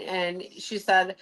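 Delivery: ordinary talk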